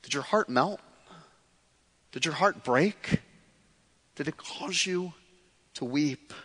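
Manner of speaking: soft, low, hushed searching questions. Real, not acted. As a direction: ask it quietly, letting it sink in. Preacher drops to near hush.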